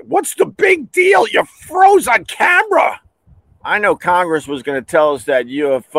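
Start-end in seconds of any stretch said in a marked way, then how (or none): 0.1-3.0 very high falsetto outburst; mock exasperation. Arguing with senator who froze, high mock squawk. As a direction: squawk it in disbelief. Acting out argument over senator freezing.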